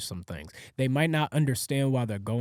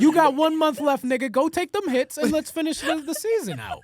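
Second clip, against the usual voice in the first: high loud mock yell; yelling strict dad; 12 semitones higher, 4 dB louder than usual. Dad voicing strict father.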